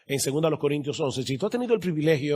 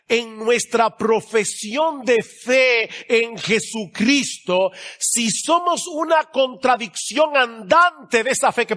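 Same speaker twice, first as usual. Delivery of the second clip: high, slow, drawn-out exhortation; full-force preaching; 7 semitones higher, 5 dB louder, much slower than usual. Real, not acted. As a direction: drive each phrase home from the pulpit.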